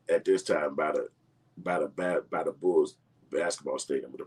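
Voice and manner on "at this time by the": ordinary delivery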